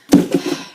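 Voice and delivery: loud, breathy sigh; heavy sigh, all air; exasperated sigh. Real, not acted. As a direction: huff it out after a rant. Worn out after rant.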